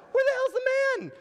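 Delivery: high, loud and fast, demanding an answer; indignant outburst. Real, not acted. Preacher asking the accusers where the man is, high and outraged.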